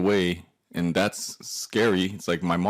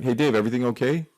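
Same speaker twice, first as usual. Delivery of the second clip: raised, higher, quick check-in from the back; calling out; 6 semitones higher, 5 dB louder, faster than usual. Retold or imitated. Bandmates in the back checking on Dave at the border, calling out. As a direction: call over to a friend, higher and louder.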